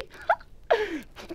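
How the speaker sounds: high gleeful giggle. A narrator's reading. Wife delighting in the gold, high giggle. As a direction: giggle greedily.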